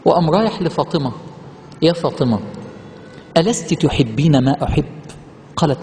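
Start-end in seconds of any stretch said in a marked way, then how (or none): 1.8-4.9 reverent hadith recitation. Acted. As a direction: recite the Prophet's words with reverence. Prophet's words recited. (تلاوة حديث بخشوع وصوت منخفض)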